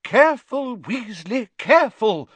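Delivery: high, loud alarmed warning; teacher's urgent high cry. A narrator's reading. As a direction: call out a sharp warning. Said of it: "cried Professor Sprout".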